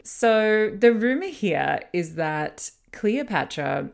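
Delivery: normal talking voice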